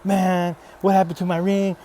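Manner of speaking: lightly hammed-up anger; mock angry. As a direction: act out your old frustrated self. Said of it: "I would've got angry".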